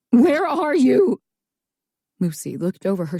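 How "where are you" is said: calling out. A narrator's reading. Voice calling from shadows.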